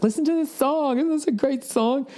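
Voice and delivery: mocking imitation. Teasing his plane companion with a high mock voice. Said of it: "he's always like".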